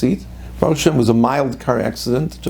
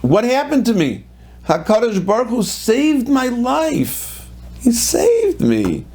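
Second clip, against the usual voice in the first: elated retelling; 9 semitones higher, slower than usual. Retold or imitated. Man retells his rescue, elated.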